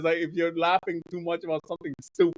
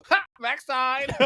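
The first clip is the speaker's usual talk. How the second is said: mom's mocking laugh; 8 semitones higher, slower than usual. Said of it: "she goes".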